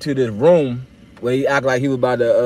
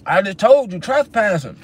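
higher, impatient officer voice; annoyed cop; 7 semitones higher than usual. Retold or imitated. Cop repeats the trespassing charge, higher and impatient. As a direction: snap the charge back like an irritated trooper.

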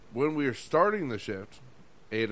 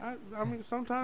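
awkward high reply; 10 semitones higher, 5 dB quieter than usual. Retold or imitated. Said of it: "I was like".

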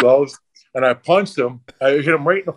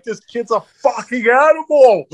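amazed shout; 10 semitones higher, 4 dB louder than usual. Retold or imitated. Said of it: "he's like".

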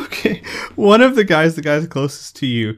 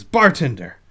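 calling out; 6 dB louder than usual. Acted. Man slaps bar, calls bartender.

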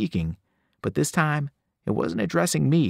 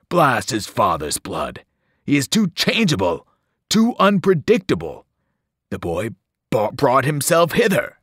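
furious monster; 4 dB louder than usual. Acted. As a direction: rage at the boy's intrusion.

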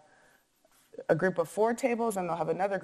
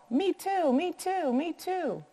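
high, eager little voices chiming in; piping up; 11 semitones higher, slower than usual. A narrator's reading. New arrivals chime in for garlic bread, piping up high. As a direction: pipe up high, one after another. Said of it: "voices piped in".

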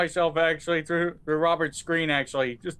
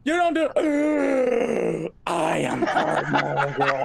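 mock heartbreak; much slower than usual. Mock heartbreak over a refused dance.

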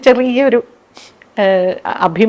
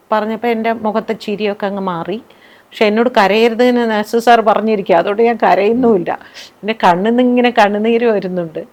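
ordinary talk

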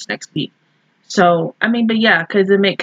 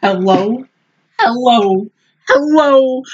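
growing disbelief; 3 semitones higher, slower than usual. Real, not acted. Astonished at next week's preview.

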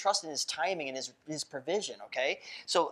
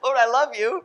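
laughing prayer; 8 semitones higher, 9 dB louder than usual. Acted. Acted joyful prayer to God.

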